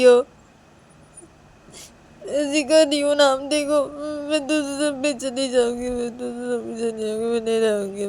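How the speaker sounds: loud, high acted wail; Manisha crying. A narrator's reading. Girl refusing to stop crying, wailing protest. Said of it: "तो मनीषा जब रो देती है".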